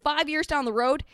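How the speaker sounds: ordinary delivery, nothing marked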